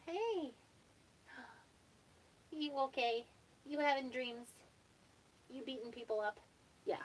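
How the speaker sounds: soft, high coo; tender pet talk. Real, not acted. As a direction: talk gently to a sleepy pet, high and hushed. Talking tenderly to waking pet.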